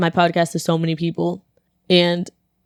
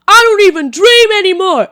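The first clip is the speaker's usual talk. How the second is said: a high, loud yell of a man ranting; high, loud retold yell; 11 semitones higher, 8 dB louder than usual. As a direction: yell it out like an unhinged stranger. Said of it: "This man yells again".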